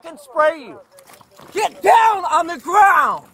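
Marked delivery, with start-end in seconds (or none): 1.5-3.3 shouting commands. Real, not acted. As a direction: bark the order to get down. Officer orders man to ground.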